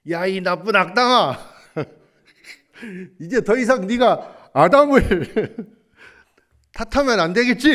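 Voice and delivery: loud scolding through laughter; laughing scold. Retold or imitated. Preacher reading God's note to the grumbler, laughing scold. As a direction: scold him while laughing at him.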